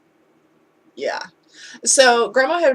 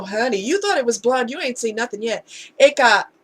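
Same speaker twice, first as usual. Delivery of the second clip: normal talking voice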